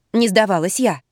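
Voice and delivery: usual speaking voice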